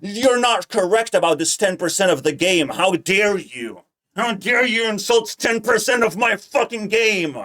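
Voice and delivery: loud, indignant rant imitating someone offended; hammed-up "how dare you" fury; mock outrage. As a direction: act mortally insulted, building to a high shout. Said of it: "And Raiden is like".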